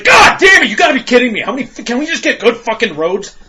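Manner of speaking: high, loud furious shout at the road; angry driver shouting at bumpy roads; road rage yell. Retold or imitated. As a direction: yell at the potholes. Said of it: "I was just like".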